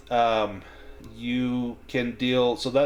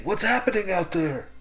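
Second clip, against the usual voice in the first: muffled voice; 9 semitones higher than usual. Acted. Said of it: "you can hear a muffled voice saying".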